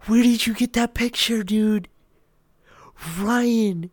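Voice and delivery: very high, loud startled outcry; shocked demand. Real, not acted. Streamer confronts friend over a posted picture, shocked. Startled by Ryan posting photo.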